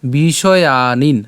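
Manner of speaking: বিষয়ানিন drawn out slowly, each sound clear; slow syllable demo. Shown as a demonstration. Teacher spelling out বিষয়ানিন slowly for learners. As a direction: stretch বিষয়ানিন. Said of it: "এরপর".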